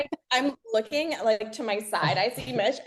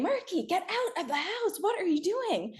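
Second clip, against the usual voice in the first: firefighter voice; 9 semitones higher, 4 dB quieter than usual. Retold or imitated. Husband voiced in retold emergency.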